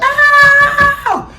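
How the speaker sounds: long high-pitched scream; drawn-out mock scream, way up high; mom screaming. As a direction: imitate a screaming mom with a loud, piercing wail. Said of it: "my mom was screaming".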